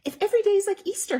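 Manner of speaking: high, loud, quick mother voice; brisk motherly explaining; mother's voice. Acted. Mother explaining to Betty Bunny, high and quick. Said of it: "her mother explained".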